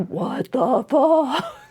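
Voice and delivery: stunned disbelief. Retold or imitated. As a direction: drag it out, gobsmacked by the twist. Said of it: "all of a sudden it's like".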